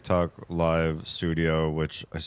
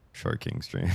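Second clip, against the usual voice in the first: much lower, quieter announcer voice; mock deep voice; 13 semitones lower, 5 dB quieter than usual. Streamer re-announcing her stream title, deep mock voice. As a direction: growl the title low. Repeats the joke low.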